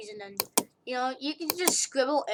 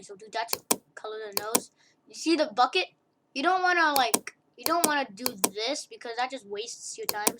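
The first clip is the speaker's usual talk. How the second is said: normal talking voice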